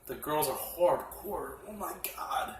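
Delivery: awed whisper. Real, not acted. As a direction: breathe it out in awe. Awed aside about the girls.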